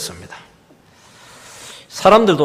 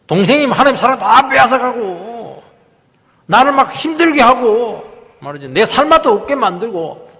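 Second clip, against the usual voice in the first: acted resentful protest, raised in pitch; angry complaint; 7 semitones higher, 5 dB louder than usual. Preacher acting Cain blaming God for his brother, angry. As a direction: hammer out grievances, drawing out the phrase ends. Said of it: "막 화를 내고 이렇게 하는 겁니다".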